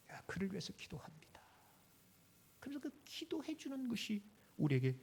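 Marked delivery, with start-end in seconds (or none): 0.1-1.4 whispered prayer. Pretend. Intercessory prayer voiced for another.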